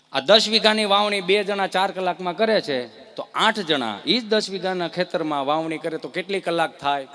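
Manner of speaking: normal talking voice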